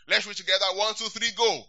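loud, high count to start; rallying count-off. Real, not acted. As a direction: call them in to read. Counting congregation into reading.

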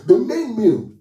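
soaring preacher cry, high and loud; exclaimed high. Real, not acted. Preacher to congregation, a high slow proclaiming cry. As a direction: lift it up and proclaim it slowly. Repeated climactic line.

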